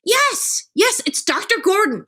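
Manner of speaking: high, eager and relieved; eager relieved yes. Retold or imitated. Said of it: "And I would say".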